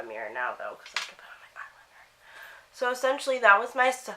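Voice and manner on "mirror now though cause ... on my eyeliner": fast, soft whisper to herself; whispered aside. Real, not acted. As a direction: murmur it while reaching for the eyeliner. Aside while doing makeup.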